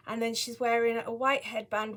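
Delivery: ordinary talk, nothing marked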